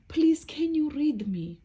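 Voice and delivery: a hint of a pleading little character; begging storybook book. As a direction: beg the listener sweetly to help. Book begs the cat.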